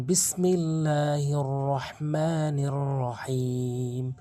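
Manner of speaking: slow chanted Quran recitation. Shown as a demonstration. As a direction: recite it slowly, drawn out.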